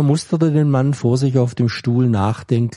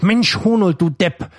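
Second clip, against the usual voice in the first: higher, sharp exasperated outburst; angry outburst; 7 semitones higher than usual. Acted. Guard to stubborn prisoner, exasperated angry outburst. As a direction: let the anger burst out at him. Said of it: "stieg Wut in ihm auf".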